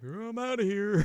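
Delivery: high, drawn-out goofy farewell; mock getaway. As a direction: sing out the exit in a high voice. Monster makes its exit.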